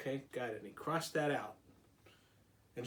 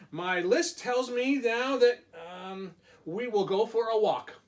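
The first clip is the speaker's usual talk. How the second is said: Toad voice; 6 semitones higher, 5 dB louder than usual. Acted.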